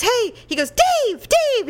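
high call to a friend; imitated calling out. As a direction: call for someone's attention down the row. Fan calls out to friend.

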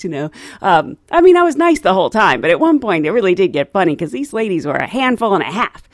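ordinary delivery

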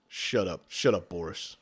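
low, curt shut-up; gruff low voice. Acted. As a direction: growl it down at him. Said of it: "He goes".

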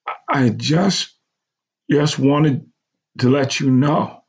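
slow, halting, holding back tears; fighting tears. A narrator's reading. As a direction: push through near tears. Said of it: "fight tears as well".

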